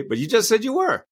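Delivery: high, teasing challenge; mock surprise. Speaker teasing his audience, high mock surprise. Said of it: "And I say".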